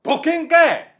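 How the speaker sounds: high, very loud comic shout; tsukkomi retort. Acted. Comedian answering a greeting with no joke, shouted tsukkomi. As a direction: holler it in mock outrage.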